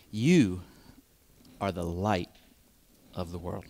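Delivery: single out "You" before the rest; stress on you. Shown as a demonstration. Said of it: "Emphasis on you".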